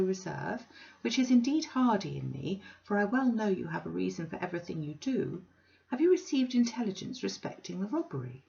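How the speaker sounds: put-on high, genteel old lady; prim Mrs Sparsit. Mrs Sparsit primly questioning Bounderby, high and genteel. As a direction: lift it into a prissy, mannered voice. Sparsit asks about robbery.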